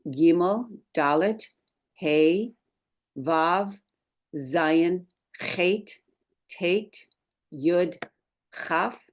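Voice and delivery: one letter name at a time, pausing after each; measured roll call with a pause between names; call-and-repeat recitation. Shown as a demonstration. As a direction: recite the alphabet slowly for learners to echo. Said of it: "go ahead and do that with me".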